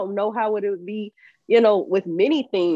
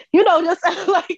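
laughing; 10 semitones higher, 4 dB louder than usual. Real, not acted. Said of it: "laughs mid-line".

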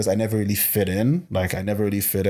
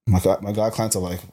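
ordinary talk

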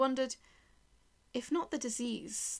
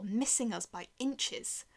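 ordinary delivery

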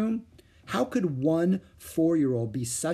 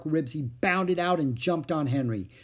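ordinary delivery, nothing marked

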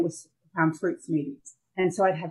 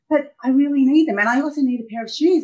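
put-on higher, pleading teen daughter, faster on the second line; imitating pleading daughter; 7 semitones higher, 6 dB louder than usual. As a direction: beg like a kid who wants new shoes. Daughter pleading for new clothes.

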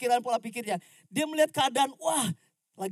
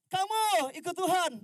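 God calling; 9 semitones higher, 7 dB louder than usual. Retold or imitated. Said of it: "ketika Tuhan panggil gini".

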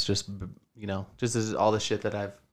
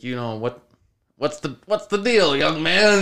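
higher, mocking old man voice, a bit louder; 6 semitones higher, 5 dB louder than usual. Retelling the old man's reply, higher mocking old man voice. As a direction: play the old man as a sneering fussy elder.

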